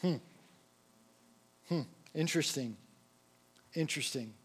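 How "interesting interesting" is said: soft musing. Shown as a demonstration. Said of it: "repeated, each one quieter".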